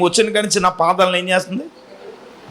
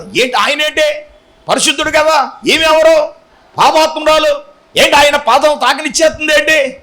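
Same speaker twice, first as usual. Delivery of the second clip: raised, indignant mock muttering of a Pharisee; a self-righteous host's scornful inner voice, mildly raised; Simon's scornful thoughts; 6 semitones higher than usual. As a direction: scoff at her like a self-righteous host. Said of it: "మనసులో అనుకుంటున్నాడు".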